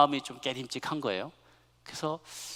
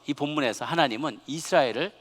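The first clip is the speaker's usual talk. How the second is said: ordinary talk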